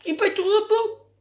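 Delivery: high giddy squeals; overexcited, laughing shrieks; too much caffeine. Real, not acted. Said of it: "je suis joie".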